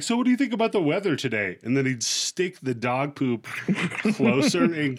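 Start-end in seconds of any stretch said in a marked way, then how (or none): 0.0-1.6 higher, fast, chirpy interviewer question; a hammed-up interviewer shoving the mic in; mock TV interviewer. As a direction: pitch it like a fake-cheerful street reporter. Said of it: "he'd be like".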